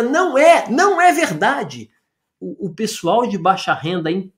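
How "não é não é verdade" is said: higher-pitched, insistent rebuttal; flatly refute the claim, voice raised in pitch; emphatic denial. Real, not acted. (negação enfática, em tom mais agudo)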